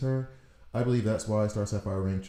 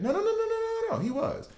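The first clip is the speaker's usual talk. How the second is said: high, rapid string of no; sing-song self correction; high excited denial; faster than usual. Real, not acted.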